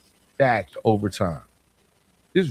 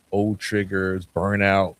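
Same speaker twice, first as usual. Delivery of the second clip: ordinary delivery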